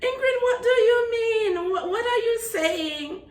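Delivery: high, baffled mock question; baffled classmates. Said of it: "they're like".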